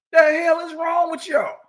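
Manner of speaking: high, loud outburst at the crowd; what is wrong with you, shouted; exasperated shout. Real, not acted. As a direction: yell it in disbelief.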